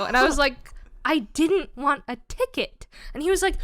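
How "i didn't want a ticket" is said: slow, higher mock protest; defensive protest. Driver excusing her fake tears to roommate, defensive. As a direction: plead your case emphatically.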